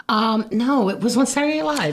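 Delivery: normal talking voice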